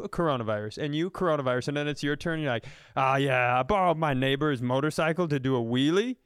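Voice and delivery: ordinary talk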